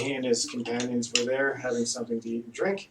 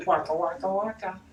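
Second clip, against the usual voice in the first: waka waka; 8 semitones higher than usual. Pretend. Joking sing-song aside.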